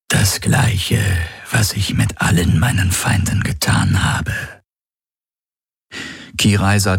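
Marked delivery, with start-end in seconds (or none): 0.1-4.6 menacing alien predator. Acted. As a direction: savour each word as a grinning alien hunter.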